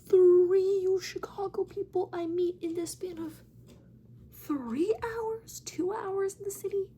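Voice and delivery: high, loud disbelief; high-pitched incredulous rant. Real, not acted. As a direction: exclaim it in mounting amazement, pitch way up. Incredulous over repeated coincidence.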